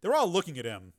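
ordinary talk, nothing marked

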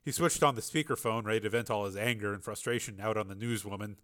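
usual speaking voice

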